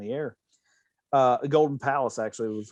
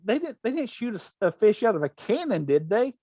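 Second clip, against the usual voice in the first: quoting Bea Arthur; 7 semitones higher than usual. Retold or imitated. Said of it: "And go".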